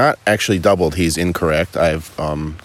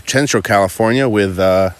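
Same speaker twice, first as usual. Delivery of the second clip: normal talking voice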